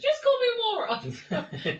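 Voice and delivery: very high, slow, loud protest; mock indignant. Teacher retelling a pupil's indignant protest, high and drawn out. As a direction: drag it out, offended.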